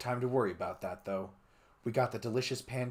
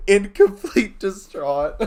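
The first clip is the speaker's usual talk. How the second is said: laughing; 10 semitones higher, 14 dB louder, slower than usual. Real, not acted. Laughing repeat.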